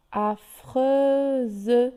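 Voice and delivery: "Affreuse" drawn out, ending in a voiced -ze; stretched Z sound. Shown as a demonstration. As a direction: split "Affreuse" slowly as a-freu-ze. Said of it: "C'est pour ça qu'elle se prononce Z". (« Affreuse » étiré, avec le son z bien marqué)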